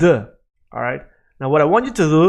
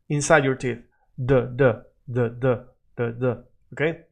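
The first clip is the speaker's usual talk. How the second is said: normal talking voice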